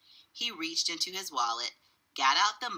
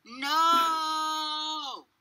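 high, drawn-out bellowed no; long yell; 12 semitones higher, 4 dB louder than usual. A narrator's reading. Father and children refuse the cashier, yelling. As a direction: yell it and hold the vowel. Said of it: "all yelled".

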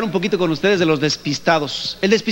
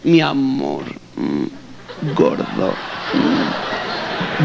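low breathy purr of pet names; purring wife; 5 semitones lower, 3 dB quieter, much slower than usual. Retold or imitated. Wife purring at her husband, wanting a gift. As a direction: purr it to get what she wants. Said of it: "empiezan así medio a rechinar".

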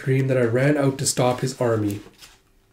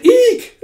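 very high, loud "eek"; mock squeal of fright; acted shriek; 7 dB louder than usual. Soldier grabbed and tossed, acted squeal.

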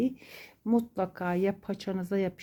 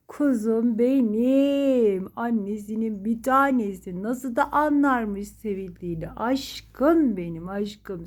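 loving coo; 2 semitones higher, 3 dB louder than usual. Real, not acted. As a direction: stretch every word out like a lullaby to a beloved pet. Cooing while petting her pet.

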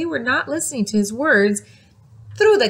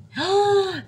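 gasp; 8 semitones higher than usual. Acted. Storyteller gasps at missing caps.

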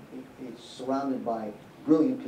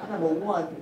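laughing; 6 semitones higher, 4 dB louder than usual. Real, not acted. Laughs at his own bad prediction.

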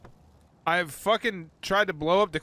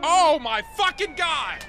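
high, loud, slow disbelief; real stunned disbelief; stunned exclaim; 10 semitones higher, 6 dB louder, slower than usual. Real, not acted. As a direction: drag out the shock. Reacting to explosion.

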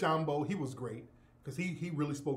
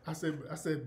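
ordinary talk, nothing marked

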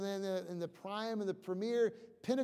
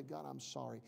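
softer, lower apology to God; humble prayer; 5 semitones lower, 12 dB quieter than usual. Shown as a demonstration. Preacher modelling a believer's confession to God, softly. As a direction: say it quietly, head bowed.

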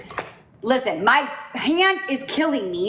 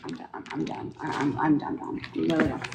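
frustrated mutter; 7 semitones lower, 7 dB quieter, faster than usual. Real, not acted. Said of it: "I'm frustrated".

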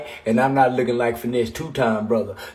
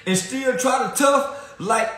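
high-pitched hype rant; high excited ranting; 11 semitones higher than usual. Real, not acted. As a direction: shout the boast at full excitement.

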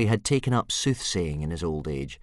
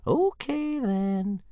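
put-on calm the boy down; soothing; much slower than usual. Old Wrinkly to Hiccup, high and soothing. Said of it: "soothed Old Wrinkly".

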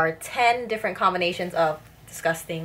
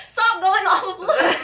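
laughing; 11 semitones higher, slower than usual. Real, not acted. Giggling after a gross bean.

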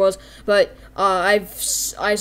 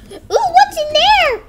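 very high, loud squeal; drawn-out, high-pitched curiosity; excited child; 9 dB louder, slower than usual. Acted. Child lured toward the service room, squealing with curiosity.

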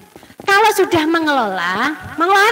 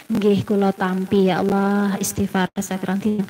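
quiet prayer; 8 semitones lower, 5 dB quieter than usual. Acted. Quiet prayer accepting feelings.

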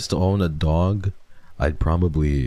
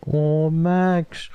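tender sad sigh; 13 semitones higher than usual. Real, not acted. Streamer reading a viewer's loss, tender and sad. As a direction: melt at the news of the dog's death.

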